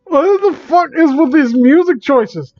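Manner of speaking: sudden high, disbelieving complaint; exasperated outburst. Real, not acted. Streamer reacts to the video's music, exasperated high outburst. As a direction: fling it out in outrage.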